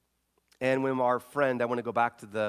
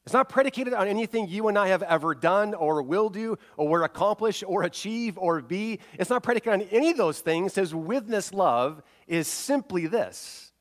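preaching at full force; 8 semitones higher, 6 dB louder than usual. Acted.

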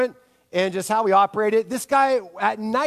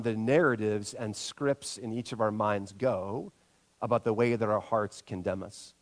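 ordinary delivery

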